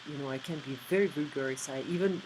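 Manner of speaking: ordinary talk, nothing marked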